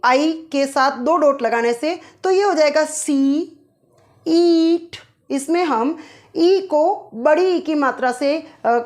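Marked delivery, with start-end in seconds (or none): 4.3-5.0 ईट with the ee drawn out long. Shown as a demonstration. Teacher showing students a long ee vowel. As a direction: stretch the ee in ईट. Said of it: "ईट ऐसे बोल रहे हैं".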